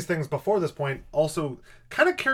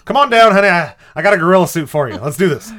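loud, higher hammy dad calling his wife; goofy dad calling; 4 semitones higher, 10 dB louder, faster than usual. Pretend. Dad calls wife to the basement, loud and giddy. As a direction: holler down like a giddy suburban dad. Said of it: "a Clark Griswold figure, is like".

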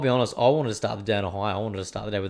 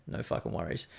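whispered; 8 dB quieter than usual. Real, not acted. Offhand remark, voice drops right out.

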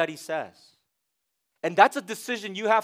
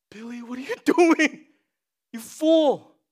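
high, louder mock scolding with a laugh; incredulous scold; 7 semitones higher, 6 dB louder than usual. Pastor scolds forgetful husband in role play, high and loud. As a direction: chide him like a fool, laughing. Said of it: "I'm sitting there like".

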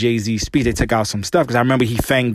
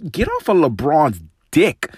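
"get off of LeBron's dick" raised, rising on "off"; first angry demand, pitched up; fed up; 4 semitones higher than usual. Real, not acted. Host telling the commentator off, fed up.

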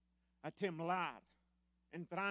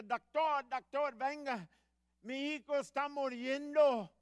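desperate call; 8 semitones higher, 9 dB louder than usual. Retold or imitated. Father calling doctor at door.

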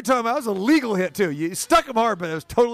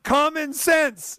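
slow, higher, stretched common sense; drawn-out emphasis; 8 semitones higher, much slower than usual. Real, not acted. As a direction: drag it out for effect. Host repeats his catchphrase.

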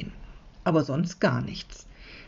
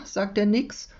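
ordinary delivery, nothing marked

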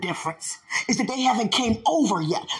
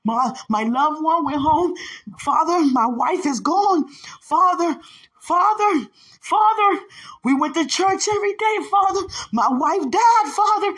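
grieving lament; 4 semitones higher than usual. Acted. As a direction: wail out the loss to God. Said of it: "Many saying".

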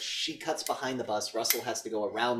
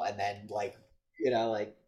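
usual speaking voice

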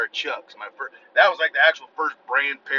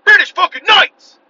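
loud, slow, higher hype shout; excited shout; 13 dB louder, slower than usual. Real, not acted. Yelling the shoe brand he wanted as a kid, loud and slow. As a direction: belt the brand name out.

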